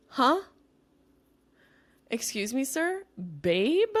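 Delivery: high, indignant disbelief; incredulous. Real, not acted. Reacting to "babe" DM.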